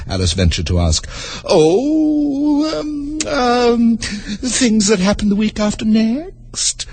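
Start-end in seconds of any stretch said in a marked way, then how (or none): 1.4-6.9 slow, offhand and high; airy, careless queen. Acted. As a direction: toss it off as if it hardly matters. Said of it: "in a careless tone".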